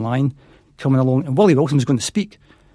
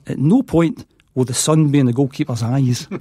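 slow quoted line. Retold or imitated. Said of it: "the most bizarre thing he ever said was".

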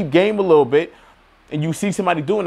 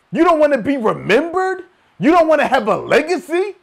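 higher, pushing questions; higher fired-up questions; rousing challenge; 11 semitones higher than usual. Real, not acted. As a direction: challenge the listener to want more.

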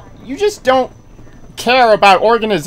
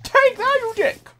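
high-pitched jab at a foe; gleeful taunt; 13 semitones higher than usual. Real, not acted. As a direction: fling it out high in triumph. Hitting an enemy.

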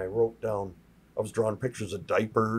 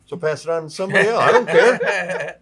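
loud, joking dismissal; hammed-up "don't care" bluster; brash brush-off; 4 semitones higher, 12 dB louder than usual. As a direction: imitate a mentor shrugging it off loudly. Mentor brushes off the remark.

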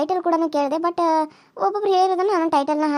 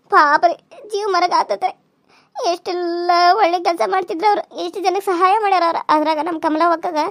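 raised, lamenting daughter-in-law voice; distressed daughter-in-law; 3 semitones higher, 4 dB louder than usual. Acted. Daughter-in-law telling mother-in-law of uncle's sudden death, lamenting.

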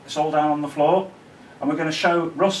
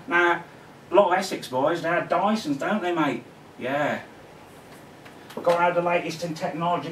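higher-pitched mock London bloke accent; 4 semitones higher than usual. Vlogger mocking Essex Dyson owners in London accent. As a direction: put on a London geezer accent, chatty and cocky. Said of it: "impersonate somebody from London".

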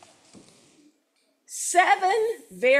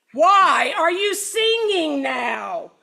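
louder, slow, growling mayor; growled; 5 semitones higher, 6 dB louder, slower than usual. Acted. Mayor growling at the rooster, slow and loud. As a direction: growl the question out slowly. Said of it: "growled Don Pepe".

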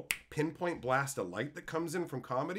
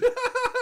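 high laugh; 10 dB louder than usual. Retold or imitated. Old lady laughing at joke.

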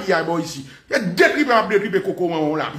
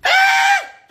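very high, piercing "eh!"; sudden yell; 4 dB louder than usual. Real, not acted. Speaker breaking off a rant to the audience, high yell. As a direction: let out one sharp high yelp.

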